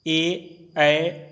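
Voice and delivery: ordinary talk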